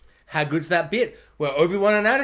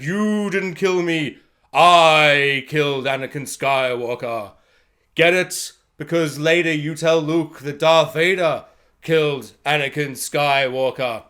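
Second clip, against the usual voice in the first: slow, lower, solemn Vader; mock Vader; 7 semitones lower, slower than usual. Vader answering Obi-Wan, slow mock solemn. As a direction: lay it on slow and grave. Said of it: "He's like".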